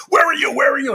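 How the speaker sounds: high-pitched mock screaming, imitating an upset wife; accusing retold yell. Wife trying to catch husband lying on the phone, mock screaming. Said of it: "she's trying to catch me as if I'm lying".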